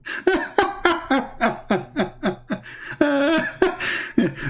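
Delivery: long, high scornful laughter; mocking laugh. Real, not acted. Amused by the opponent's answer, he laughs mockingly. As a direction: laugh him down.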